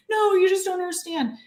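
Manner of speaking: high-pitched imitation of a distressed mom; upset mother. Mom answering her doubting daughter, upset.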